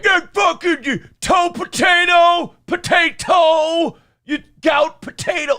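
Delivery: high, drawn-out hammed-up shouting; mock mad yelling. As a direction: yell it like an angry mob, stretching the words. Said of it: "they're getting mad".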